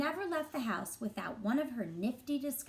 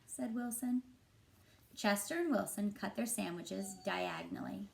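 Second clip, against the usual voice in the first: ordinary delivery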